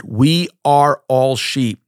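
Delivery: "We are all sheep" drawn out word by word, weight on "all"; slow emphatic stress. Real, not acted. Preacher tells congregation they are all sheep, slow and emphatic. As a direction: spell it out slowly, leaning on "all".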